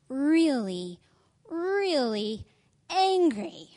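climbing stress on really really angry; building emphasis. A narrator's reading. Reading title, building emphasis.

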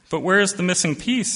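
lightly voiced small girl, eager; a hint of a curious child; little girl voice. Little girl asks Old Turtle.

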